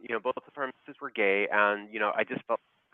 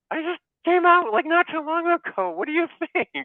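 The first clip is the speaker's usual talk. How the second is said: incredulous disbelief; 7 dB louder than usual. Retold or imitated. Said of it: "I'm like".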